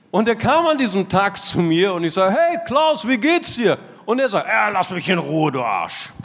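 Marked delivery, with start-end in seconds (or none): 2.4-3.8 high, upbeat mock-friendly hello; cheery greeting. Pastor greets his homeless friend Klaus, cheery and high. 4.5-6.1 mock-grumpy growl, dropping low at the end; grumpy brush-off. Homeless Klaus brushing off the pastor, mock annoyed. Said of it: "er war total ärgerlich".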